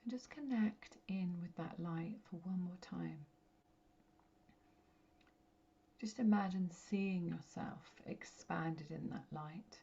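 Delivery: guided meditation. Real, not acted. Medium guiding listeners to connect with their light, meditative. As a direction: guide them gently inward, phrase by phrase, letting each settle.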